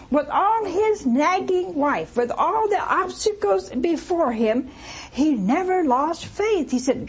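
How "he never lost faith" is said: normal talking voice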